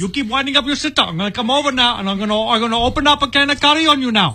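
put-on high, rapid Indian-accented scolding; comic dad threat in a put-on Indian accent; mock Indian accent. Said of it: "is an Indian accent".

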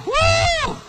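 very high wailing woo like a storm; loud high howling woo. Shown as a demonstration. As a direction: let the wind howl loud and high. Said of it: "get loud".

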